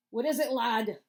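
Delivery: put-on old Scots engineer asking the lad; Scottish accent. Scottish engineer asks the radio man, light Scottish accent. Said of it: "there's a Scottish guy in here".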